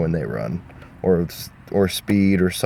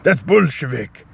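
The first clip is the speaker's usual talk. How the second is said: Shrek voice; 2 semitones higher than usual. Acted. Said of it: "Shrek was like".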